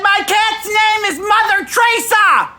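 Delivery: a high, emphatic retold boast; excited declaration. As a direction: announce the cat's name with delight. Proudly naming his cat.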